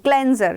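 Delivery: क्लेंजर repeated with a short "e"; teacher's example of क्लेंजर, "klen" not "kleen"; correct cleanser pronunciation. Shown as a demonstration. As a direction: model क्लेंजर as "klen-zer". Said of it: "pronunciation का ध्यान रखना है".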